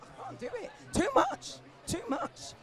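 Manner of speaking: high, whiny mock overwhelm; hammed-up high wail of too much work. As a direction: play it up, swamped and pleading. Mock overwhelmed self-quote.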